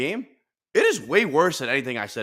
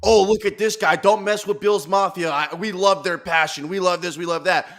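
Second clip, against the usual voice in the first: mock fawning fan; 5 semitones higher, 4 dB louder than usual. Mimics fans fawning over Bills.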